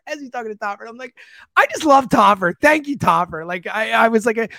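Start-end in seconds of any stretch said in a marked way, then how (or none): 1.6-3.4 loud, giddy fan gushing; retold starstruck outburst. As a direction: gush like a kid, loud and high. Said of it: "like, gushing".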